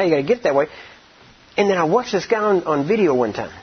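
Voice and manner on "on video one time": ordinary delivery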